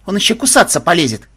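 high, loud complaint; indignant. A narrator's reading. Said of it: "возмутилась Мелисса".

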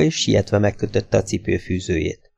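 plain reading voice

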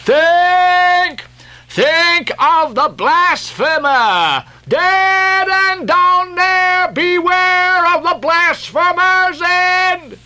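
very high, slow wailing prophet's warning; vehement exclamation. A narrator's reading. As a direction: cry out doom in long-drawn, chanting high tones. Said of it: "vehemently exclaimed".

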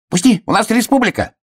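high squeaky protest; indignant hedgehog. A narrator's reading. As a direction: demand release in a high little voice. Hedgehog rushes to the rescue. (возмущённый писк ёжика)

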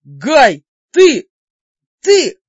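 loud, outraged yelling; furious shout. Acted. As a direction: shout it out in fury. Furious friend loses his temper.

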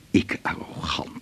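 hushed, slow and stung; whisper. Acted. Daan whispers to his colleague, hurt at being called arrogant. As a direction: breathe it out in disbelief.

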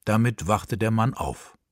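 ordinary delivery, nothing marked

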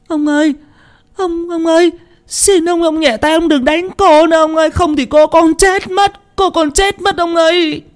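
put-on high-pitched wailing servant girl; tearful pleading. A narrator's reading. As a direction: beg desperately in a very high, sobbing whine. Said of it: "mà khóc lóc mếu máo". (giọng khóc lóc mếu máo van xin)

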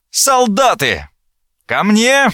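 high, drawn-out call for help; shouted summons. A narrator's reading. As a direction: call your soldiers over, voice pitched high. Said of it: "И Сьерре закричал". (крик-призыв высоким голосом)

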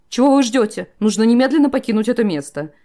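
loud alarmed exclamation; booming warrior king. A narrator's reading. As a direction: shout it in panic. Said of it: "воскликнул король".

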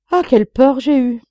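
loud, high sigh of relief; relieved exclamation. Acted. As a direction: let the fright pour out. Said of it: "soulagé par cette rectification".

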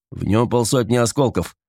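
ordinary delivery

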